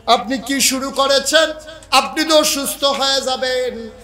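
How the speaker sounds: high acted remonstrance; wife calling out. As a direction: voice a worried wife in a preacher's high call. Wife calls out in story.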